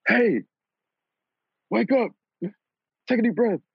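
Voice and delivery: a high, sing-song mock nurse waking him. Nurse waking the patient after surgery, high mock nurse voice. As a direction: squeak out the nurse's wake-up call like a caricature. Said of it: "It was like".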